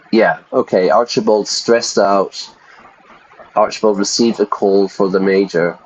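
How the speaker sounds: ordinary delivery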